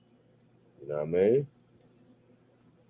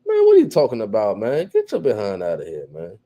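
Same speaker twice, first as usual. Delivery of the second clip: mocking dismissal. Real, not acted. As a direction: wave him off with growing mockery. Laughing off viewer's complaint.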